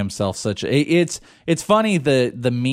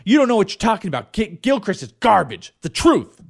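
higher, mock-indignant fan comeback; internet troll; 6 semitones higher than usual. Host acting out a troll defending Bogman, mock indignant. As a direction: fire back as an over-defensive superfan troll. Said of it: "you could just troll anybody".

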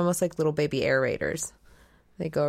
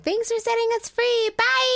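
tiny ladybug voice. Pretend. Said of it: "They were like".

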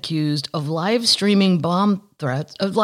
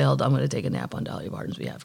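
rattled off fast and a bit softer; fast aside; 6 semitones lower, 7 dB quieter, much faster than usual. Retold or imitated. Joking about napping for Dolly, rattled off quickly. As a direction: toss it off quickly, half to yourself. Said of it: "I'll say".

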